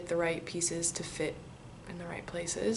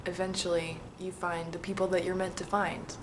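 usual speaking voice

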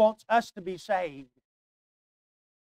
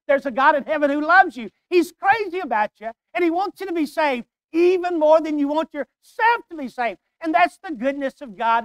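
higher, louder preacher's build; rising pulpit fervor, pressing each line; preaching at full force; 7 semitones higher, 5 dB louder than usual. Acted. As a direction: climb to the peak of the sermon. Preaching peak.

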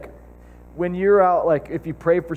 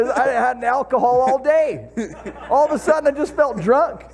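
amazed retelling; 8 semitones higher than usual. Retold or imitated. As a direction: tell it like you still can't believe it. Vet's amazed account retold.

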